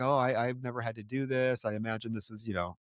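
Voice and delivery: lightly voiced, soft fatherly comfort; a hint of a calm father soothing a sick girl; fatherly reassurance. Intruder calming the sick girl, softly fatherly. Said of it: "very fatherly".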